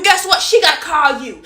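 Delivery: normal talking voice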